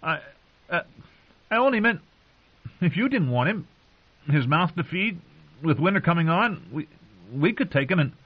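high, halting nervous stammer; flustered stammer. A narrator's reading. Sam stammering an explanation to the glaring wildling, high and halting. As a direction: stumble through it, scared.

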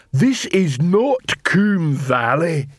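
slow troll warning. Acted. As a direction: warn the crowd heavily.

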